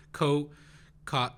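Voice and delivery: ordinary talk, nothing marked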